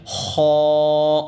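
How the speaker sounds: خا loud, rough, lips rounded; teacher chanting rounded خا; rounded rasping letter. Shown as a demonstration. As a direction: round the mouth and scrape خا. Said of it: "منہ کو گول کر دینا".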